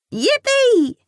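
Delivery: put-on very high, loud child's cheer; excited exclamation. A narrator's reading. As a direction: squeal it with delight. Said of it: "she exclaims".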